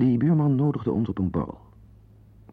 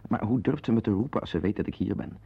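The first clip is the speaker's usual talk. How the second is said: ordinary talk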